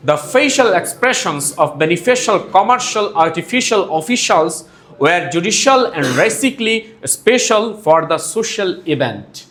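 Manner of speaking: very slow, every syllable shaped; careful slow teacher demo; slow tongue twister demo. Shown as a demonstration. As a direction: spell it out slowly for learners. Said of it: "আবার পরেরটা দেখুন".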